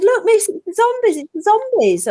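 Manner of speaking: put-on high, eager child's cry; excited children. Children pointing out the zombies to the dinner lady, excited. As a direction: kids pleading with the teacher to look. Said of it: "And we said".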